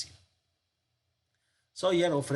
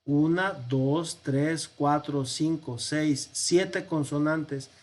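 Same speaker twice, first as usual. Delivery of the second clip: slow counting; slower than usual. Shown as a demonstration. Teacher counting consonants in the manuscript aloud, slow and deliberate. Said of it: "Y aquí tenemos".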